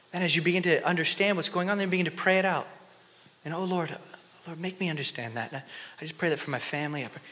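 ordinary talk

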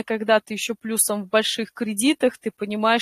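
ordinary talk

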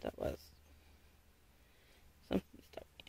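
whispered aside. Real, not acted. Puzzled by something sharp, a whispered aside. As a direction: whisper it under your breath.

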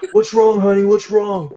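high, loud, frantic concern; hammed-up panicked boyfriend; mock panic. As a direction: plead helplessly with someone in tears. Acting himself baffled by crying girlfriend.

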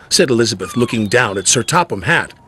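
ordinary delivery

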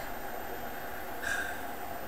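long breathy sigh; heavy sigh. Real, not acted. Realizing the video can't come back, long sigh. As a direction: let out a long, soft sigh. Resigned after video takedown.

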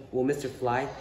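usual speaking voice

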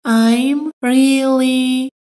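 "I'm really" drawn out very slowly; slow it right down for learners; very slow, careful. Shown as a demonstration. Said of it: "teacher repeats slowly". (خیلی آهسته و شمرده)

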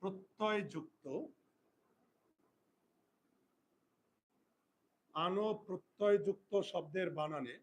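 slow and spaced for writing down; teacher dictating the rule to pupils, slow; slow dictation. Shown as a demonstration. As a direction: dictate word by word.

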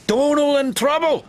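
high, shocked Scottish question; alarmed cry. A narrator's reading. Douglas hears of his twin's accident, alarmed cry. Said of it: "Douglas cried".

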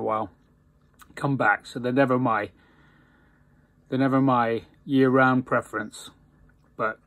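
speaking with clenched teeth; talking around pipe stem. Real, not acted. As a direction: pipe held in mouth. Seen 3: Pipe stem clenched between teeth throughout, hand holding bowl below.

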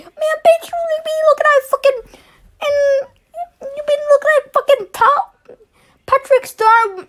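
high squeaky mocking voice; mock insult.

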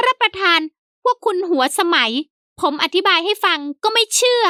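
high, eager fawning servant. A narrator's reading. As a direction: pitch it up as the polite old servant. Servant Haew complains.

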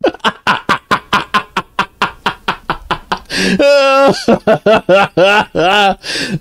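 helpless, drawn-out laughter; long laughing fit. Real, not acted. Streamer hearing the clip again, long helpless laugh. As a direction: laugh till out of air. Clip played one more time.

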